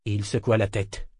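usual speaking voice

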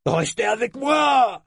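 slow, high, loud bawl; bawling chief. Acted. The chief bawling at his fleeing men, loud bawl. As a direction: yell after the deserting men. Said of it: "brailla le chef".

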